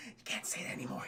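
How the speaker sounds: hushed, voiceless whisper; whispered aside. Pretend. Streamer warning chat about a dated slur, whispered.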